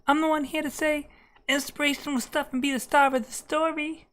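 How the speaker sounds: [name] impression. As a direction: speak in a high [name] falsetto. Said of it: "I just do a [name]".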